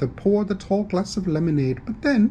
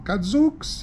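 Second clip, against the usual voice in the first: surprised exclamation; 8 semitones higher than usual. Acted.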